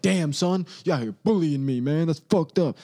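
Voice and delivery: half-hearted wounded complaint; mock hurt star. LeBron answering a mean commenter, mock hurt. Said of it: "And LeBron's like".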